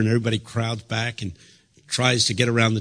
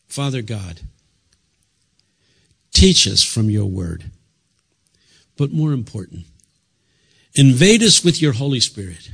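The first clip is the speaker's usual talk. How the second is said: earnest prayer building louder; 5 dB louder than usual. Acted. Preacher leading the congregation in prayer, building fervent. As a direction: pray it out, rising in fervour.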